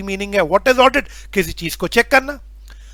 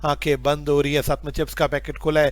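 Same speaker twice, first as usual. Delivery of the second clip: sleepy student voice; 5 semitones lower, 3 dB quieter than usual. Retold or imitated. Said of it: "ये नहीं कि".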